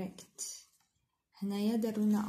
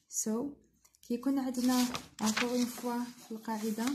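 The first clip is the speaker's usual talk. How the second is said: normal talking voice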